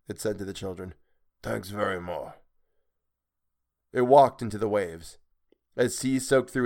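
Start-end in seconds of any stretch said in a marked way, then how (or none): 1.4-2.4 soft inhuman thanks; hushed creature voice; sea creature. A narrator's reading. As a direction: thank them quietly as a strange beast.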